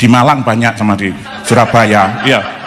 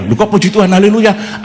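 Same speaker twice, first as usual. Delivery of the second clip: surprised friend; 6 semitones higher than usual. Retold or imitated.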